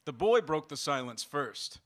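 ordinary delivery

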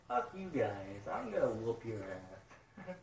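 slow, soft mock threat; a hint of swaggering menace, toned down; mocking tough guy. Fan imitating Jack's defiance in a mild mock sneer. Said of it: "I love Jack's attitude".

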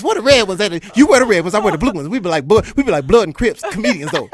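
high, loud, hyped rambling; loud giddy fan chatter; overexcited babble. Retold or imitated. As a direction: gush nonstop like a starstruck fan. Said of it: "I started doing impersonations".

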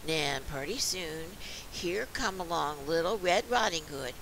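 ordinary delivery, nothing marked

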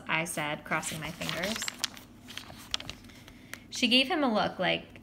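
ordinary delivery

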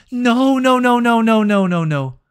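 dreading the kick; real panic; anxious no's. Real, not acted. Watching the decisive kick.

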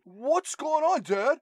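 high-pitched mock-clueless son; Paul Walker. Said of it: "Paul Walker's like".

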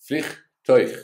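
"Vliegtuig" said high and loud, with a guttural g at the end; model word. Shown as a demonstration. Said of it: "ending in U I G".

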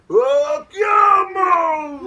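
very high ringing shout; high, loud chant leader's cry; loud call. Shown as a demonstration. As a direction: call the chant out to the whole forest.

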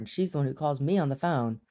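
usual speaking voice

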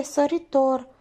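a hint of a prim nun telling tales; gossiping nun. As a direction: tattle like a pious old nun.

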